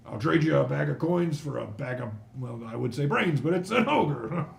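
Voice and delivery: hearty constable's quip, ending high; jovial dwarf constable. Acted. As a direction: joke heartily at full voice. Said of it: "looks and he goes".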